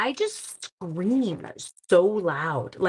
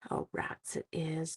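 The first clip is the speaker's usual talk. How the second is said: whisper; 3 semitones lower, 12 dB quieter, slower than usual. Real, not acted. Finding the piece too big.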